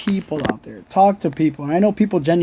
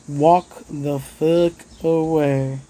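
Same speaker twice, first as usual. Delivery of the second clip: slow emphatic; much slower than usual. Real, not acted. Urging viewers to leave toxic people.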